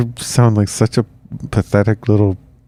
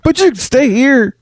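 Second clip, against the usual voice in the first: high mock voice. Mocking ruler voice.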